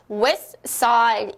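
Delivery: "West Side" as wes-side, T left out; dropped T. Shown as a demonstration. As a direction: model "West Side" with no T between the words. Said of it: "T가 생략이 됩니다". ("West Side"의 T를 빼고 발음)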